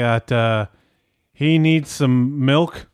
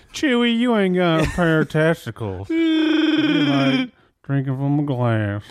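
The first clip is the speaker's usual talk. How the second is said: Han Solo impression; 5 semitones higher than usual. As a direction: hit the famous line like the smuggler talking to Chewie. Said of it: "I was pretty good at that".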